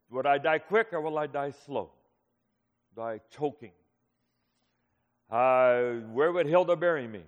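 ordinary talk

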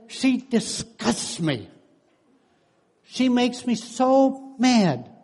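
lightly voiced fed-up husband; exasperated husband. Husband tells counselor about wife, slow and annoyed.

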